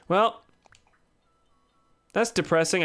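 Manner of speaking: ordinary delivery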